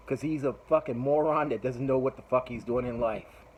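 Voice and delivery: usual speaking voice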